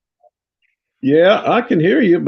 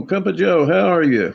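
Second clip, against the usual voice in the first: ordinary delivery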